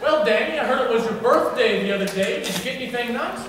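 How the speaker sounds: ordinary talk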